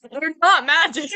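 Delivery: words through real laughter; laughing. Real, not acted. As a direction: laugh out the line. Laughing while telling.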